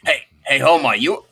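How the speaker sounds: Moe. Acted. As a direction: play a hurt cartoon bartender on a voicemail. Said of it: "You just hear Moe going".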